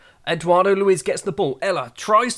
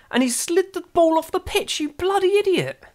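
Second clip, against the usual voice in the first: real frustration, pitched way up; exasperated yell; 12 semitones higher than usual. Real, not acted. Manager yelling at his own player for blundering, exasperated high yell.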